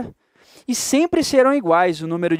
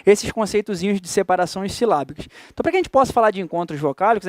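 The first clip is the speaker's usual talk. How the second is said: ordinary delivery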